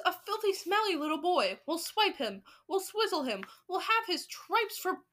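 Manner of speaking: high, eager witches' clamour; crying out. A narrator's reading. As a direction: threaten with excited relish. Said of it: "cried the witches".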